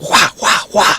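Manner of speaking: put-on kid's play-combat "wah" noises; punchy pretend martial-arts yelps; mock fighting cries. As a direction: imitate a child swinging a toy sword. Child swinging wooden stick at air.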